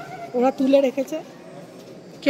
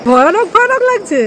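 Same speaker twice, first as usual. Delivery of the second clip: loud, high amazed question; surprised echo; 6 semitones higher, 10 dB louder than usual. Real, not acted. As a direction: repeat it back in disbelief. Echoing the visitor's answer.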